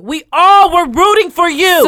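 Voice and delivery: loud, slow rising lament; emphatic disappointment. Real, not acted. Cohost laments the singer's betrayal, loud and slow. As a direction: stretch it out, heartbroken.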